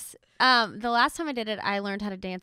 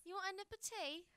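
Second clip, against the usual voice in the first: a badly faked British accent, higher; 8 semitones higher, 17 dB quieter than usual. Host tries a British accent she says she is bad at. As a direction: put on a clumsy British lilt. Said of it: "I am so bad at British".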